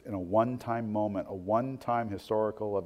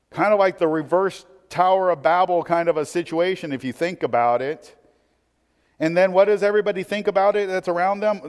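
louder, higher-pitched preacher delivery; impassioned preaching; 9 semitones higher, 9 dB louder than usual. Real, not acted. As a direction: drive the point home to the congregation, loud and high. Preaching at raised intensity.

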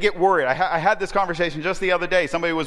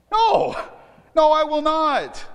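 much higher, louder preacher's No; emphatic refusal; 11 semitones higher, 4 dB louder, slower than usual. Acted. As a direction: hammer the refusal home from the pulpit. Preacher voicing Paul's rebuke.

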